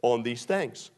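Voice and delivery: loud, high, ringing sermon delivery; full-force preaching. Acted. Preacher urging the congregation to change their thinking, full-force preaching.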